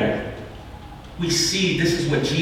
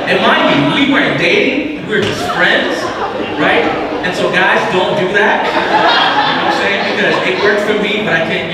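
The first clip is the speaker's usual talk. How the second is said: louder, higher, laughing warning; excited aside; 6 semitones higher, 6 dB louder than usual. Real, not acted. Pastor warning young men in the crowd, loud laughing aside. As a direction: laugh as you warn the guys off.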